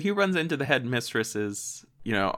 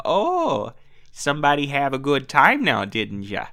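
teasing Minnesota "didn't ya"; Minnesota accent; 4 semitones higher, 4 dB louder than usual. Retold or imitated. Host voices Barb teasing Cliff, Minnesota accent. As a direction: tease him gently, Minnesotan. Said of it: "And she's like".

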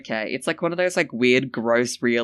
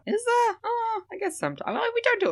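very high squeaky voice; faster than usual. Acted. Huge pitch jump.

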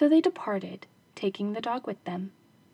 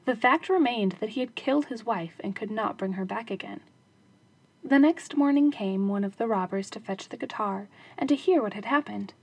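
usual speaking voice

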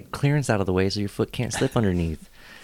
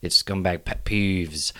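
slow title read; 3 semitones lower, much slower than usual. Acted. Announcing the episode theme.

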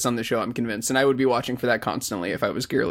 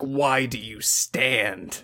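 anime villain line; 3 semitones lower, much slower than usual. Retold or imitated. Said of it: "fucking anime-ass line".

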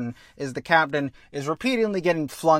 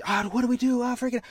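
mock panic; 9 semitones higher, faster than usual. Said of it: "and they're like".

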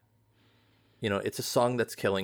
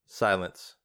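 normal talking voice

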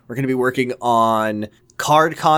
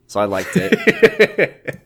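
laughing; 6 semitones higher, much slower than usual. Real, not acted. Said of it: "laughing conclusion".

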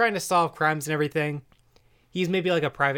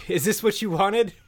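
mock outburst, lightly raised in pitch; higher-pitched, acted demand at the audience; acted Cecil; 8 semitones higher, 4 dB louder than usual. Cecil turning on the camera mid-beating, mock outburst. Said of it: "Cecil turns to the camera".

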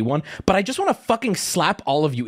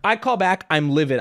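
ordinary delivery

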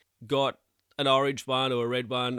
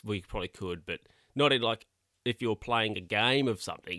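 normal talking voice

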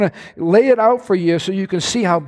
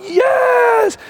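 excited shout. Real, not acted. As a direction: burst out cheering. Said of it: "I'm having fun".

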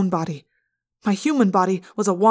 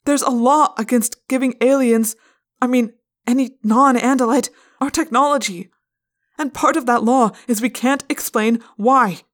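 higher, louder, effortful; strained explanation; 5 semitones higher, 6 dB louder than usual. A narrator's reading. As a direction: explain under pressure. Cornered narrator explains under pressure.

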